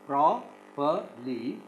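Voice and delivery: very slow "properly" in three separate pieces; "properly" with a gap after each syllable. Shown as a demonstration. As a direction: spell out "properly" as pro, per, ly. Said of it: "we divide this into three syllables".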